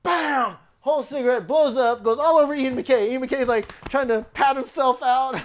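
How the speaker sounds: the big payoff of the prank story, thrilled; excited retelling. Real, not acted. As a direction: build it up loud as the cigarette blows. Climax of the exploding cigarette story.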